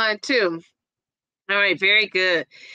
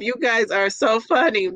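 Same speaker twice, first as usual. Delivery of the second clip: ordinary delivery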